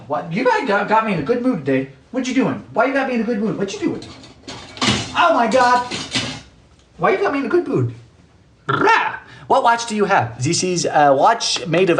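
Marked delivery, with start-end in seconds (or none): 2.1-7.9 playful teasing. Pretend. Teasing chatters. 8.7-9.2 playful roar. Pretend.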